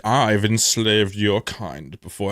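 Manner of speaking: slow, sinister lizard voice; slow creepy villain voice; menacing lizard. Retold or imitated. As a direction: draw it out as a smug threat. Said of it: "He's like".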